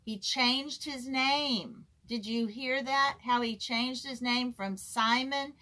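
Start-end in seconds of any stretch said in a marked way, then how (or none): none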